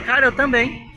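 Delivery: high, loud laughing reply; a high, loud me too; excited agreement. Retold or imitated. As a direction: burst out with high enthusiasm. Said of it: "Eu dizia".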